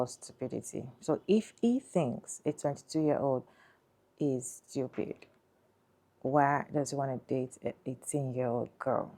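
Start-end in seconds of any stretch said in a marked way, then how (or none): none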